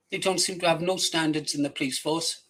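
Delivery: usual speaking voice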